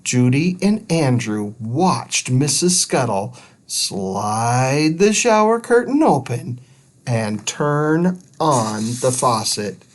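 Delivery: plain reading voice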